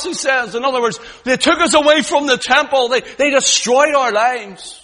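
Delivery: loud, high, rising preacher's cry; full-force preaching. Acted. Preacher crying God's love to the congregation, full force. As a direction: preach it out to the whole hall.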